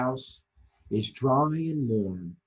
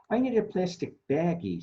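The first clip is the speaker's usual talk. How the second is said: lightly voiced toy, a bit higher; a light lift for the stingray toy; 6 semitones higher, faster than usual. Storyteller lightly voicing Stingray for children. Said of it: "says Stingray".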